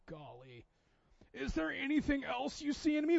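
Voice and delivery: hammed-up vain preening voice; mocking self-admiring voice. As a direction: play the vain guy fishing for praise. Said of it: "I was like".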